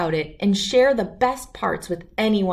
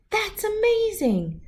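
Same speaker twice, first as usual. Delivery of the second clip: high, slow gasp of amazement; be truly wowed by the news; amazed; 14 semitones higher than usual. Acted. Child marvels at the Nobel Prize, amazed.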